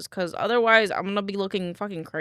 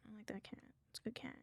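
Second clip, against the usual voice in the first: whispering; 2 semitones higher, 21 dB quieter, faster than usual. Real, not acted. Cooing over cat picture.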